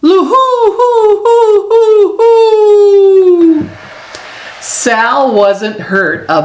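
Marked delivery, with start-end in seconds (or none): 0.0-3.7 high, slow hooting loon call, much louder; loon laugh. A narrator's reading. Narrator imitates the laughing loon by the water, high hooting call. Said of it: "and the loon laughed".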